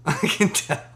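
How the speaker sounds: slow, high, laughing through the words; laughing repeat. Retold or imitated. Mocking a newbie rapper's boast, laughing slowly. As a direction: crack up while repeating the jab.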